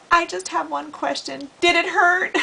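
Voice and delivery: high, smirking pickup-line voice; teasing girl. Retold or imitated. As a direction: tease him with a sly grin. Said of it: "and she goes".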